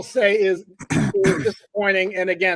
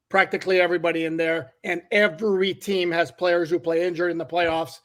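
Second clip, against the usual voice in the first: usual speaking voice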